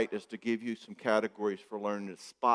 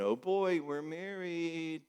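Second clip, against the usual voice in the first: smug newlywed; 5 semitones higher, 4 dB quieter, slower than usual. Retold or imitated. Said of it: "he's gonna be going".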